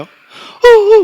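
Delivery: high, loud mock sob imitating how women cry; pretty crying. Shown as a demonstration. Pastor mimics women crying prettily, high mock sob. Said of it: "여자들이 울 때 예쁘게 울잖아요".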